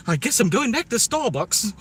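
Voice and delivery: hammed-up English accent. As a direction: say something American like a Brit. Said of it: "American things with an English accent".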